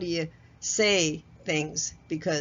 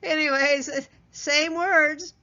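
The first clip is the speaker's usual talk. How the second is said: laughing; 8 semitones higher, 5 dB louder, slower than usual. Real, not acted. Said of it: "I always have to chuckle after I do those".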